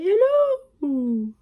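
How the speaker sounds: high, loud call of hello; calling out into a cave. Pretend. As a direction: shout to hear the echo. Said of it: "you say".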